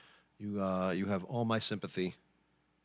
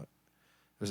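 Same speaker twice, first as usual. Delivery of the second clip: ordinary talk, nothing marked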